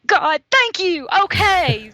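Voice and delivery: high, drawn-out burst of excited thanks; thrilled relief. Retold or imitated. Woman retelling her reaction to a possible alien, thrilled. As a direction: exclaim it slowly, voice shooting up high.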